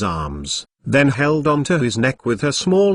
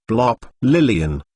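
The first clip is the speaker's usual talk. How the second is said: ordinary delivery, nothing marked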